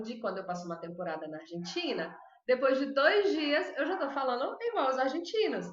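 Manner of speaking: ordinary talk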